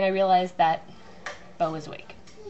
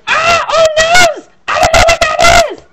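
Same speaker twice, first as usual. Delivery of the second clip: panicked chickens; 18 dB louder than usual. Pretend. Wife voicing the chickens refusing the coop, panicked screech. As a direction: scream like a terrified hen. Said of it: "are like".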